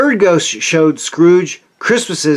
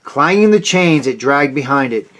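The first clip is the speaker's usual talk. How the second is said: ordinary delivery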